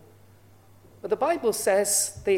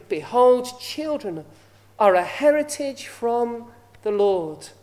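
Behold rung out loud, then slow solemn reading; scripture reading; 5 dB louder than usual. Acted. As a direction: proclaim the verse slowly. Reads scripture aloud.

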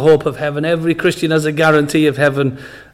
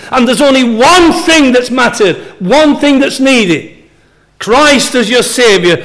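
raised, urgent pulpit voice, higher; preaching; 4 semitones higher than usual. Acted. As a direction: press the point home to the congregation. Preaching heaven as true home.

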